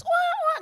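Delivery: loud, high-pitched bawling imitation; mock child wail. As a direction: wail like a crying little grandchild. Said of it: "kayak cucu saya nangis". (menirukan tangis keras anak kecil)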